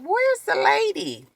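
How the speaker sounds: high-pitched, drawn-out wondering; incredulous questioning. Retold or imitated. Retells her puzzled thought in the chair.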